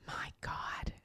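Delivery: choked, breathless whisper of emotion; tearful whispered my God. Real, not acted. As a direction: barely get the words out, moved to tears. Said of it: "I'm getting emotional again".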